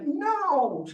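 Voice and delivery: the last, most insistent no; third firm no. Retold or imitated. Owner scolding misbehaving dog, high firm no. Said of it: "And then a third time".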